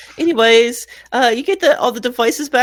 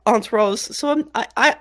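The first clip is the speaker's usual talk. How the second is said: usual speaking voice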